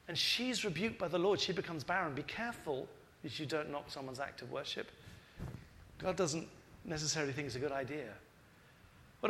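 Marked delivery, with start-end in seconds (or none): none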